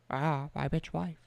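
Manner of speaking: soft, slow creepy mimicry; mock creepy bird mimic. Streamer imitating a parrot-like monster, slow and soft. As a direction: drawl it like a bird copying a voice. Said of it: "the birds would be like".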